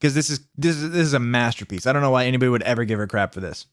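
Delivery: ordinary talk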